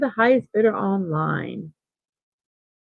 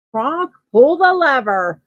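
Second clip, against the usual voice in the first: higher, louder, drawn-out order; mock-dramatic command to a henchman; imitated villain command; 5 semitones higher, 5 dB louder than usual. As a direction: call it out slowly like a theatrical villain. Quoting a cartoon villain's command.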